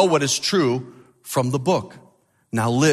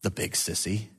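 shaky mutter; 6 semitones lower, 11 dB quieter than usual. A narrator's reading. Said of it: "a shaky voice saying this".

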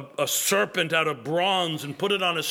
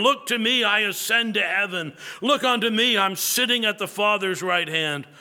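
rising preaching refrain; 4 semitones higher than usual. Retold or imitated. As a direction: build a pleading refrain. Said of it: "the good man followed up his text in this way".

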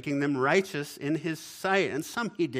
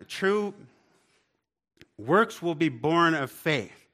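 "True" punched high and loud, then slow; stress on true; 5 semitones higher, 7 dB louder, slower than usual. Shown as a demonstration. Preacher hammering home that faith bears works, emphatic. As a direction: hit "True" hard and pause before "works". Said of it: "I wasn't gonna do the hand motion".

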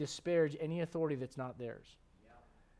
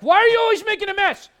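much higher and louder mock yell at a toddler; pretend yell; 15 dB louder than usual. Father pretending to yell at baby Olivia, shouting. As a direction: scream it like an exasperated parent. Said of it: "if I were to yell at Olivia".

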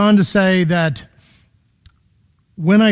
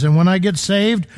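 ordinary delivery

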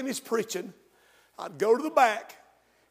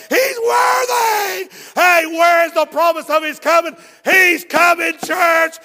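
louder, higher, hammering each line; preaching at full force; 6 semitones higher, 11 dB louder than usual. Acted. Pastor stirring his church, full preaching roar. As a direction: preach it like a revival climax.

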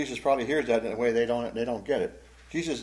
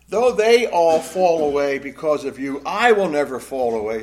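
proud declaration; 9 dB louder than usual. Acted. Peter answering Jesus before the other disciples, proud declaration. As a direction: declare it forcefully, setting yourself above the rest. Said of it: "hear the hint of pride in that?".

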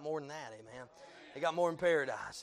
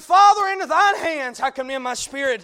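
fervent cry; 7 semitones higher, 16 dB louder than usual. Acted. Repeated with rising fervour.

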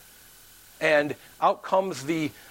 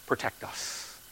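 very soft, almost whispered appeal; hushed plea; 11 dB quieter, slower than usual. Retold or imitated. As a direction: plead it under the breath. Imagined plea to the courts.

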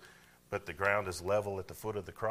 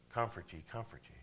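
soft comforting tone; 2 semitones higher, 7 dB quieter than usual. Shown as a demonstration. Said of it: "what do you think he's crying?".

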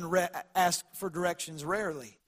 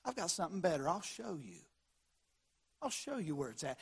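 soft tender voice; 2 semitones lower, 11 dB quieter than usual. Acted. Tender invitation.

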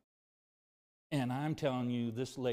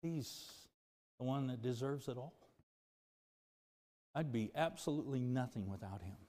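ordinary talk

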